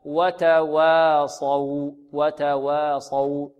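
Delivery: "وتواصوا" slow, light waws round a full heavy saad. Shown as a demonstration. Teacher sums up the rule, slow heavy saad in "وتواصوا". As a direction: stretch "وتواصوا", thickening only the saad. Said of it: "ننطق هكذا".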